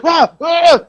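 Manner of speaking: high-pitched mock cries of a man caught out; flustered cry. Old man caught outside the office, flustered cries. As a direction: imitate a startled, wordless yelp.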